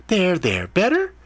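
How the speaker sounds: gentle. A narrator's reading. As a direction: soothe him like a sick child. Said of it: "the large cat said gently".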